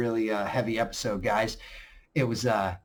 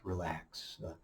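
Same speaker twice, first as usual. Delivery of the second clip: calming voice; 8 semitones lower, 15 dB quieter than usual. Retold or imitated. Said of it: "trying to calm down".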